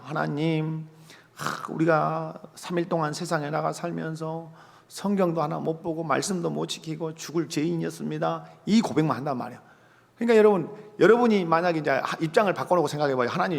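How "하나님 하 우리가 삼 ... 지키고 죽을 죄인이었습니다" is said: acted penitent murmuring to God, sighing; mock contrite prayer. Congregant confessing three days of failure to God, mock contrite and hushed. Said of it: "막 죽을 죄인이라고만 해야 한다".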